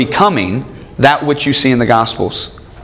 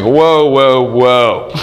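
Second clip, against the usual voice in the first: mock alarm; much slower than usual. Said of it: "I'm like".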